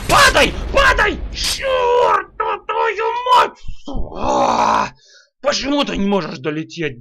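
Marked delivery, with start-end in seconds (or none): 0.1-1.2 shouting. Real, not acted. Yelling at falling object. 1.3-4.9 angry growl. Real, not acted. Failing the level.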